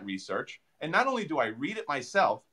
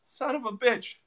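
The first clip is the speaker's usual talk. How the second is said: surprised realization; 8 semitones higher, 7 dB louder than usual. Retold or imitated. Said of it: "I said".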